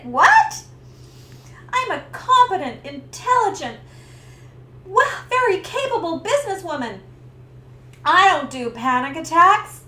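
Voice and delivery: high, loud indignant protest. Retold or imitated. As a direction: protest in offended disbelief. Indignant disbelief.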